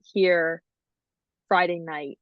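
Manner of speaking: ordinary delivery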